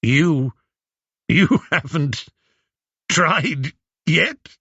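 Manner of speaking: mocking laugh through words; laughing in disbelief. A narrator's reading. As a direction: scoff and laugh as you repeat it. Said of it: "before bursting out with laughter".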